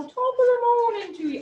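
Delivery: high, mock English gent accent. Teacher voices the monocyte with a mock English accent. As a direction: chirp it like a mild-mannered English gent in a top hat. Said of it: "I don't know why he's English".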